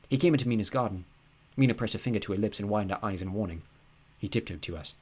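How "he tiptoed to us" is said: ordinary delivery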